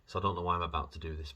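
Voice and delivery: ordinary talk, nothing marked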